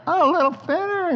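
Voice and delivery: high comic satisfaction; squeaky delight. Pretend. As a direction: coo it like a pleased cook. Admiring the tenderized meat.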